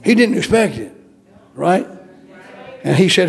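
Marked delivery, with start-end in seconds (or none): none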